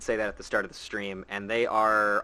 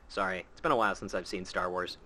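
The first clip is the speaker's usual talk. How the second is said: normal talking voice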